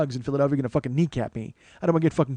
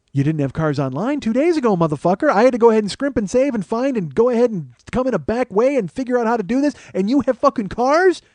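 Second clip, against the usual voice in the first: incredulous rant, climbing higher and louder; acted outrage; 7 semitones higher, 5 dB louder than usual. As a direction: build to an indignant, stretched-out last question.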